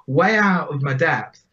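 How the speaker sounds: ordinary talk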